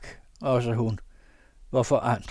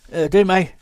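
gruff farmer reply; 8 semitones higher, 12 dB louder than usual. Acted. Pastor answers from the dung heap.

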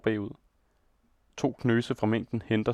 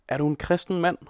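much higher reproachful shout; crowd shouting; 7 semitones higher than usual. A narrator's reading. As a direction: call him out in outrage. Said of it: "råber nu flere stemmer". (bebrejdende råb)

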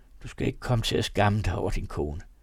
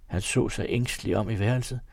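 ordinary delivery, nothing marked

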